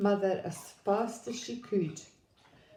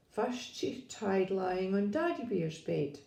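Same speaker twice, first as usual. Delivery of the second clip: usual speaking voice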